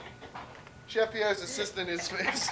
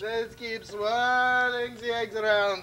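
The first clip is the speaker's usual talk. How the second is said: slow, hammed-up French chef accent; mock French chef; slower than usual. Chef stirring the eggs in a mock French accent. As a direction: croon it like a showy French chef. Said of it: "I'm out of character".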